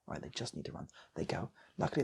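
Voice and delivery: ordinary delivery, nothing marked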